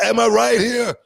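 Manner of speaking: loud, high-pitched demand for agreement; worked-up rhetorical question; heated appeal. Real, not acted.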